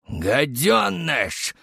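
slow, drawn-out spat insult; lieutenant cursing the soldier; mild shouted insult. A narrator's reading. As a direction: stretch the insult out in anger. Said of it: "закричал Старлей".

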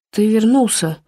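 drowsy, half-awake mumble; sleepy mumble. A narrator's reading. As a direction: mumble it as if just woken. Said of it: "сонно пробормотал Грегори".

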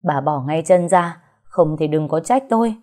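ordinary delivery

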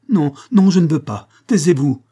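high, loud, frantic refusal; anguished cry. A narrator's reading. Monique refuses to hear her fault, anguished cry. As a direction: cry out as if in pain. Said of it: "cria Monique en se tordant".

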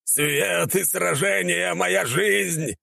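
high, drawn-out battle cry; put-on heroic cry, squeezed up high; shouting for show. A narrator's reading. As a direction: cry it out slowly, just for show. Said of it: "кричал он это явно для галочки".

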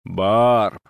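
low, distinct call of a name; deep voice calling out of the dark; a mysterious low summons; voice in the night. A narrator's reading. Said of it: "вновь услышала голос".

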